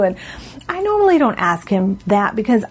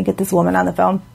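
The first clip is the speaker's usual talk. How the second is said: normal talking voice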